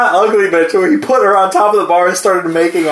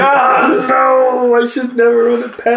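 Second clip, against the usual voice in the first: mock dismay; 7 semitones higher, slower than usual. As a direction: cry out in fake dismay. Retold own dismay at dead fish.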